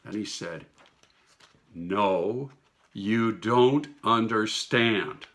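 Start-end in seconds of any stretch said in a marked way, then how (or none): 1.7-5.3 loud, slow and firm; very emphatic. Retold or imitated. Jesus correcting the dreamer, loud slow emphasis. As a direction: lean hard on every word, slowly. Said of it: "very emphatically".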